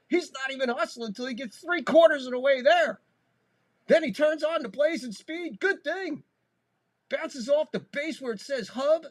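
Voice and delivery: breathless broadcaster call for a big hit; excited play-by-play. Real, not acted. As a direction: ride the thrill of the double. Excited home run call.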